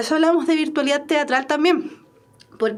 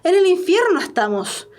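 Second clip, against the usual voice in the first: higher, astonished run of questions; stupefied; 5 semitones higher, 4 dB louder than usual. Acted. Cleto stupefied, asking where on earth they are, astonished. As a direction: rattle off the list in disbelief.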